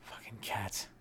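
whispered under the breath; whispered curse. Real, not acted. Comic cursing the cat in a whisper. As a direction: hiss it to yourself.

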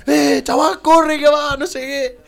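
high, loud re-enacted yell; shouted warning. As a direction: call out urgently to a mate far off. Pupils shouting a warning to the smoker.

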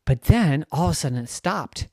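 ordinary delivery

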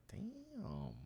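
tiny, high, hushed exclamation; stunned aside. Retold or imitated. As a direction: let it slip out softly, way up high. Stunned reaction to the price.

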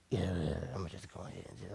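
low, quick mumbled compliance; imitated meek surrender, hurried and low; wary backing down. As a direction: rush it out low, avoiding trouble.

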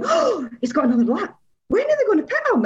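high-pitched retold worry; fretting at the screen, pitch up high; retelling watching the race, high worried voice; anxious disbelief. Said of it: "I was sat there glued going".

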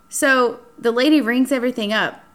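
ordinary talk

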